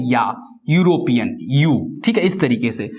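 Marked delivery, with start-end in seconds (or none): 0.1-1.7 यूरोपियन begins with a clear "यू" glide; yoo sound. Shown as a demonstration. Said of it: "ई का sound क्या है". (यू का उच्चारण)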